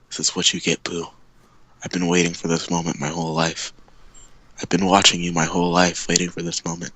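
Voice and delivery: low, soft villain voice. Acted. Villain gloating over choking Pooh, low and menacing.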